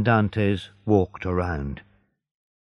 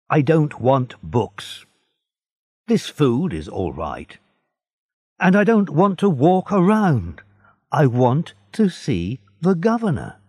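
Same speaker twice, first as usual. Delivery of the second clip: a hint of stubborn Dantes, higher and louder; lightly voiced insistent prisoner; 7 semitones higher, 4 dB louder than usual. A narrator's reading. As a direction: refuse everything, insist on the governor.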